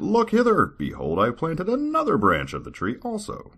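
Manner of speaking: high, grand proclamation; grand lord. Acted. God showing servant his tree, high and grand. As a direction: call out proudly like a lord. God's quoted speech, raised.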